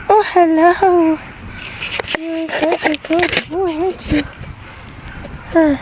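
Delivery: high sing-song dog talk; squeaky praising a dog. Real, not acted. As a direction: coo up high at the pet.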